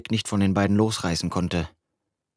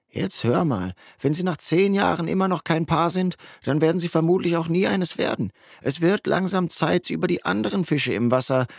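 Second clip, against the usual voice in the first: put-on higher, teasing young friend; higher friend's voice, egging him on; buddy Justin's voice; 8 semitones higher than usual. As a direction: play the nudging best mate, pitched up.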